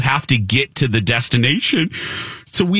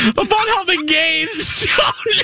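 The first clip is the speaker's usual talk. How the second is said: very high squeal through laughter; laughing mock scream. Retelling Haley's screaming to friends, helpless laughter. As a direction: retell the screaming while cracking up. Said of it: "Haley keeps screaming".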